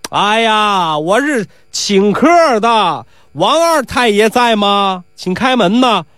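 high, slow, drawn-out call; calling through door. Acted. Nisi asking Wang Er's household to open up, calling out. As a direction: stretch each phrase out to carry inside. Polite caller shouting through gate.

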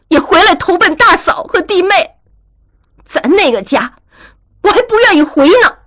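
high-pitched, huffy retort; indignant. A narrator's reading. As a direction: snap back at a brother, offended. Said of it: "气愤地说".

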